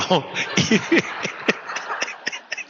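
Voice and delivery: airy laugh rising in pitch; breathy high laugh. Real, not acted. Preacher laughing at his own joke.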